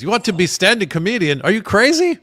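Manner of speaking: put-on loud, high Greek dad accent. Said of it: "his thick Greek accent".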